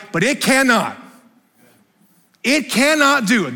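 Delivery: loud, high, driving preacher's voice; full-force preaching. Acted. Pastor preaching to the congregation, loud and driving. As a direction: hammer the point home to the congregation.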